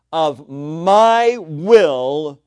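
MY and WILL hugely stretched and loud; slow, booming weight on MY WILL; drawn-out emphasis. Real, not acted. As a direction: drag out "my will" at full volume. Preacher hammers climax of definition.

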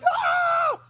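very high, loud hammed-up yelp; mock scream. Father yelping as son puts feet on frame. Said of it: "I'm just like".

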